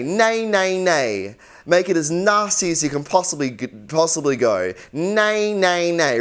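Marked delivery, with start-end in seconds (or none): 0.0-1.4 nasal nay. Shown as a demonstration. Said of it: "Just say". 4.9-6.2 nasal nay. Shown as a demonstration. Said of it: "really nasally".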